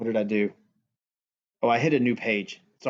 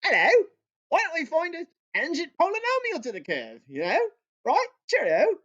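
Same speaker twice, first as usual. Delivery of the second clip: high, posh-playing mock British accent; 14 semitones higher than usual. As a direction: imitate a jolly Englishman, pitched up high. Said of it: "Taylor was British, so he'd be like".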